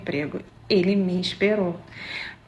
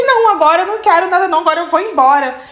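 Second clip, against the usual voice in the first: fast, higher and louder indignant refusal; revolted refusal; 7 semitones higher, 7 dB louder, much faster than usual. Retold or imitated. She turns down his late kiss offer, fast and indignant. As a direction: snap it off and storm away. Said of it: "eu fiquei tão revoltada".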